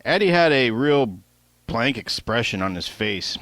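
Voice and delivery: ordinary delivery, nothing marked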